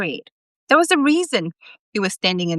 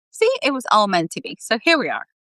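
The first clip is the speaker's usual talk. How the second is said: usual speaking voice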